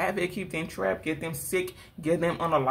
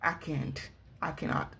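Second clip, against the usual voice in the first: soft giving up; 4 semitones lower, 9 dB quieter than usual. Real, not acted. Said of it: "I ain't even about to play with your accent".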